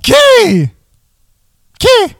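high honking goose call; Canadian goose voice. Pretend. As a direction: honk the word like a goose. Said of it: "You sound like a Canadian goose".

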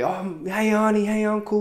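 high, bright call to the neighbours; cheery kid greeting. Retold or imitated. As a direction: greet them like a happy kid.

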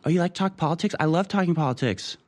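lightly voiced keen party girl; imitating a girl. Party guest mimics a girl eager to discuss politics, mock girly. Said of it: "Some girl being like".

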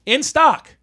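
high, loud and showy; mock sales promise. Website luring buyers who need boxes, mock sales promise. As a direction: announce it like irresistible bait.

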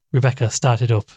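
usual speaking voice

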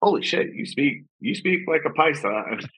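imitating a friend's stunned amazement; amazed exclamation. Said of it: "And he's like".

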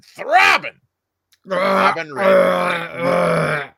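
loud, drawn-out ringmaster call; ringmaster announcement. Acted. Announcing patron name big.

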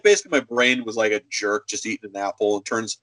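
ordinary talk

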